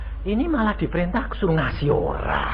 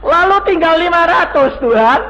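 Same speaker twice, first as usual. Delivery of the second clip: loud cry out; 11 semitones higher, 10 dB louder than usual. Real, not acted. Preacher crying out to his congregation, loud. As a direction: call it out loud to the crowd.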